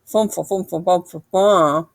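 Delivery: lisping round vampire teeth; mushy talk through fake teeth. A narrator's reading. Dragon in vampire teeth, mumbling through them. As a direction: mumble like a mouth full of plastic fangs. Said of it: "could not talk very well with vampire teeth".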